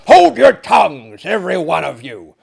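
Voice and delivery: furious shouted command; great rage. Acted. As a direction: roar at the whole table. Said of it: "said my host in a great rage".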